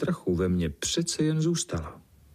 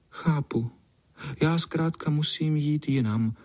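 higher, resigned little voice; sighing cat; 6 semitones higher than usual. A narrator's reading. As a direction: accept it with a small sad sigh. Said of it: "A ona vzdychla". (povzdechnutí smutné kočky)

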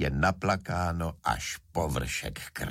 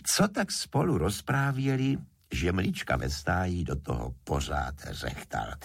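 usual speaking voice

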